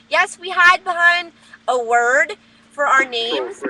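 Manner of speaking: ordinary talk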